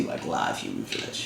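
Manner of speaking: low breathy whisper; creepy whisper. Pretend. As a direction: hush it like a creep. Creepy joke about flesh.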